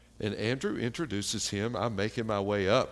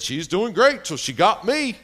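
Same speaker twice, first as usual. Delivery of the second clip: high, loud imitated husband; loud piping-up; 10 semitones higher, 12 dB louder than usual. Husband joking to visitors about his wife, loud high interjection. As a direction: chime in loudly with a boast.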